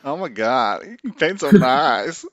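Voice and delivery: put-on slow drawn-out valley girl accent. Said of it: "The, the valley girl accent".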